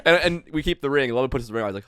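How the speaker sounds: ordinary talk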